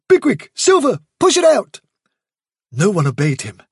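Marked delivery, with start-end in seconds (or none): 0.1-1.8 much higher, louder orders; Blackberry's high commanding voice. A narrator's reading. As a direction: urge the others to shove the board.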